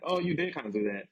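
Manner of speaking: normal talking voice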